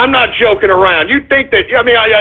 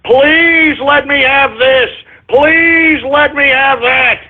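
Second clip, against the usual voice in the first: drawn-out, higher-pitched pleading; slow singsong mock plea; mock begging; 4 semitones higher, slower than usual. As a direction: imitate a whiny beggar, stretching each please. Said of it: "and begging".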